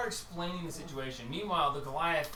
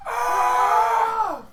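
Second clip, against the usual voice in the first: high, loud raging yell; loud high furious bellow; raging roar; 11 dB louder than usual. Acted. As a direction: let out a wild angry roar. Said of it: "just go out there and just".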